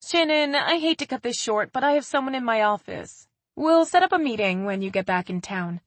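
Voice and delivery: formal wrap-up of a call in front of a client; a light touch of office politeness; business tone. A narrator's reading. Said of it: "Haley's tone switched to business".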